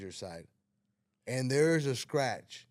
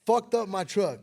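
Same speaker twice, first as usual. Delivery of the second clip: higher, louder yell, half laughing; mock angry yell; 8 semitones higher, 6 dB louder, slower than usual. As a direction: yell it at the friend, cracking up. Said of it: "I'm like".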